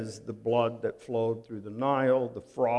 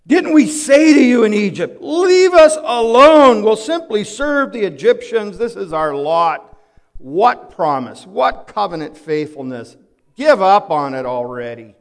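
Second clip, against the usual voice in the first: loud, higher whining protest; bitter complaining; 6 semitones higher, 12 dB louder than usual. Acted. Israelites grumbling at Moses about Egypt, loud complaint. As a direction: nag and scold like a fed-up crowd. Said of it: "They're complaining. They're murmuring".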